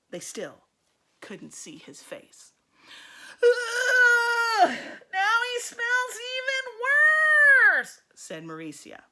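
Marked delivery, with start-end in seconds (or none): 3.4-8.0 high, whiny "ooh, it stinks" with a drawn-out complaint; disgusted girl. Acted. Girl in class groans at the stink, high and whiny. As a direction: squeal it in disgust, slowly.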